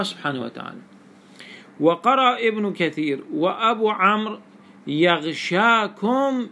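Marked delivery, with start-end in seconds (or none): 4.9-6.5 يغشاكم drawn out slowly as yagh-shaa-kum; long 'aa' in يغشاكم, opening on ya; alif and fatha. Shown as a demonstration. Teacher showing Ibn Kathir's reading, يغشاكم as yaghshakum. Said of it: "بالألف".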